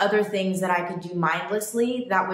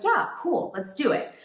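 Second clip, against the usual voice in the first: eager yes; 8 semitones higher than usual. Retold or imitated. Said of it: "And I was like".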